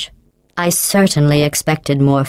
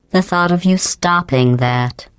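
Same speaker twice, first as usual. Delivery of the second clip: ordinary talk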